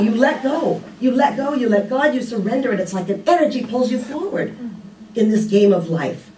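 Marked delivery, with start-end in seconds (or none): none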